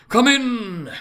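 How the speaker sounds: loud, high hearty call; booming exclamation. A narrator's reading. Ghost welcoming Scrooge into the room, booming. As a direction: boom it out like a jolly giant. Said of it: "exclaimed the ghost".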